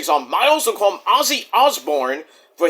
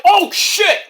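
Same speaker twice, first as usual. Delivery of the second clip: a sudden high "oh shit!"; shocked outburst; 12 semitones higher, 5 dB louder than usual. Retold or imitated. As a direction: blurt it out as if caught off guard. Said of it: "I'm like".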